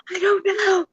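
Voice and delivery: high-pitched mock-spooky wail; Blair Witch scare. Mom scaring her kids in dark woods, high mock-spooky.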